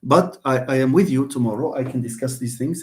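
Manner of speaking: ordinary talk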